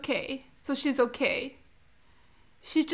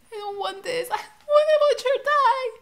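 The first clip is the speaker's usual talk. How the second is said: sobbing, a bit higher; a choked plea through tears; crying; 9 semitones higher than usual. Real, not acted. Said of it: "That's why I'm crying".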